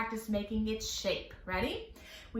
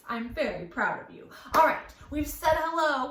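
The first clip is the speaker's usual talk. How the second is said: usual speaking voice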